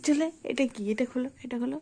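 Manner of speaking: high, soft coaxing voice. Real, not acted. Coaxing a small child.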